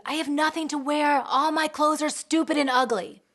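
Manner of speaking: put-on loud, high whining child, near tears; a ten-year-old wailing over clothes; whiny daughter. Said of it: "and she's crying".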